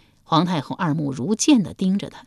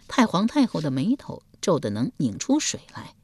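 usual speaking voice

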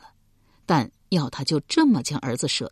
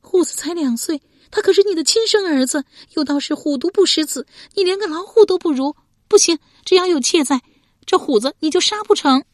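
high, loud tearful protest; anguished, wailing defiance; crying; 13 semitones higher, 6 dB louder than usual. A narrator's reading. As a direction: defend your child through tears. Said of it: "哭着说".

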